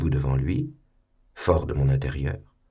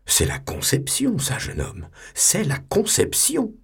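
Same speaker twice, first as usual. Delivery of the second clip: much higher, emphatic lecturing; insistent correction; 13 semitones higher than usual. Acted. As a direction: stress conception, correcting the boy. Superior insisting on dogma.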